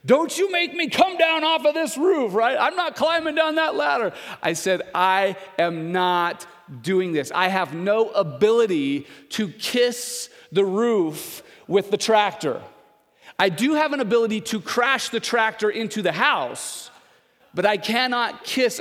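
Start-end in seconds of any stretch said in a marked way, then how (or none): 0.0-4.1 much higher, louder angry boss yelling down from the roof. Retold or imitated. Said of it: "he's yelling at me". 4.9-18.8 firm refusal. Retold or imitated. Refusing the boss firmly.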